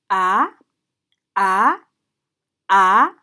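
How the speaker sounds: questioning upward "Ah"; rising second tone. Shown as a demonstration. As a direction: lift each loud "Ah" like a question. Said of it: "the second tone, uh, rises".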